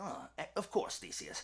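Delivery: very soft, trailing low reply; quiet, understated assent; hushed false courtesy. Acted. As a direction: murmur agreement to a guest. Secretly jealous king answers guest.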